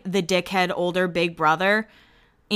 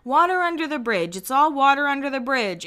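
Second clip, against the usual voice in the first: mock yelling Stephanie; 5 semitones higher than usual. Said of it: "Cut to Stephanie yelling".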